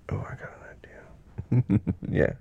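normal talking voice

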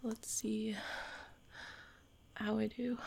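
hushed, breathless whisper. Real, not acted. Whispering while distracted, breathless whisper. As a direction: whisper it under your breath. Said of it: "A little bit engaged".

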